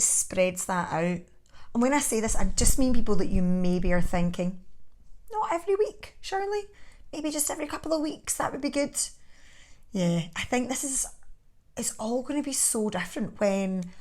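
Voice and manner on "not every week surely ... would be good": high, light voice for someone else's thought; much higher, slightly softened quoted reply; imagined listener's objection. Acted. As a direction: pitch it up as a hesitant objector. Voices what listeners may think.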